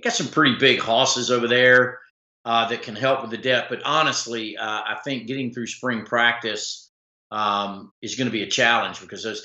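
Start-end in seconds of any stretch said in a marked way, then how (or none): none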